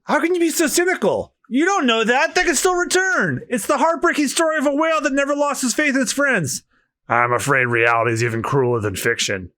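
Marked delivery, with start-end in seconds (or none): 0.0-6.6 put-on much higher, earnest pleading girl; high young woman voice. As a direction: plead as a heartfelt young heroine. Voicing a young woman character.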